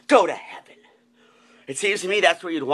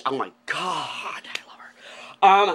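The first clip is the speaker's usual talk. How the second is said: whisper; much slower than usual. Real, not acted. Gushing over the witch.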